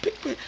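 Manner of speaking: high clipped scratch sound; scratch mimic. [name] scratching in, high mimic. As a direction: chop it short like a scratch. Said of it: "[name] got hold to it".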